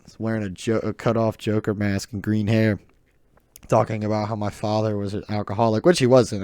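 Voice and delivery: ordinary talk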